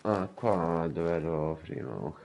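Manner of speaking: normal talking voice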